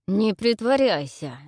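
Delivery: slow loud growl; growling king. A narrator's reading. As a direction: snarl it slowly at her. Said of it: "зарычал он".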